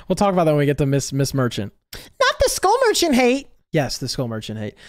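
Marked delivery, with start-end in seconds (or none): 1.9-3.5 very high put-on whine; mock protest. Reviewer mimicking viewers objecting to hate, mock protest. 3.7-4.7 low, soft, unmoved reply; deadpan confirmation. Real, not acted. Reviewer answering protesting viewers about the hate, deadpan confirmation.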